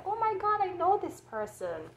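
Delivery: high, starstruck fan squeal; mock-starstruck fan gasping at a famous face; gushing fan. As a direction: gush like a fan who spots a celebrity. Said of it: "for people can see them face and say".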